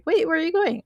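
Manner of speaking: high-pitched, imitated protest; mock startled question. Chloe questioning Lucifer as he rushes off, mock startled.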